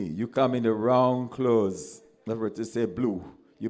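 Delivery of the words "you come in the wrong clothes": slow and loud, each word pressed; emphatic warning. Real, not acted. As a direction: warn the listener firmly. Warning about the strict captain.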